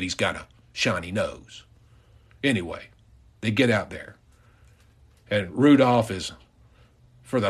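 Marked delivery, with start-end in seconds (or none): none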